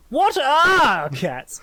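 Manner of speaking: high, loud, very drawn-out question; mock bafflement. Host introducing cats as topic, in hammed-up bafflement. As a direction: stretch it slowly with hammed-up wonder.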